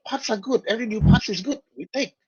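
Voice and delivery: high-pitched broken-English creature; Jawa voice. Acted. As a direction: squeak it out as a small Jawa. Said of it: "And after that, the Jawas actually".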